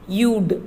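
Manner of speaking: "You'd" as one syllable, yood; short form you'd. Shown as a demonstration. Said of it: "How to pronounce this short form".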